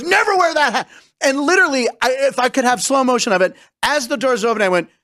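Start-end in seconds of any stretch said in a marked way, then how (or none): 0.0-0.9 angry order. Retold or imitated. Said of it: "grabs me and throws that and goes".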